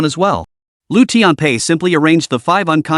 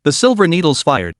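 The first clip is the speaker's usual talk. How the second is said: ordinary delivery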